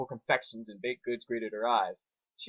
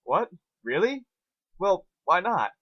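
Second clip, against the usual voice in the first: surprised; 7 semitones higher, 6 dB louder, slower than usual. Acted. Twilight shocked by no list.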